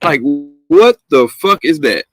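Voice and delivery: ordinary talk, nothing marked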